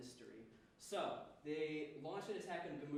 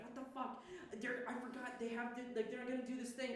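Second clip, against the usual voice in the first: high, hurried alarmed blurt; a sudden burst of alarm; mock panic; 8 semitones higher than usual. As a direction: play her realizing the danger too late. Murbella panicking in retold scene.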